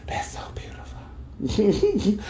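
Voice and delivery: slow, barely-there whisper, moved to tears; tearful whisper. Retold or imitated. Telling listeners how the show moved her, tearful slow whisper. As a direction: re-enact being overcome, voice gone to a soft whisper. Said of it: "that's when tears came rolling down my cheek".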